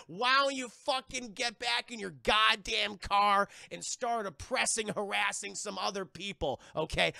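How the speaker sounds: hammered-out hostile telling-off; mock angry tirade. Hammerhead personality telling off officers, mock angry tirade.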